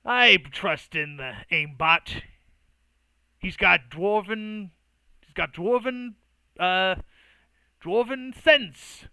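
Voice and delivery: loud, slow, emphatic dwarf; dwarf figure. Acted. Dwarf vouching for a companion, loud and emphatic. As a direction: hammer each phrase with swooping pitch. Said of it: "kind of goes".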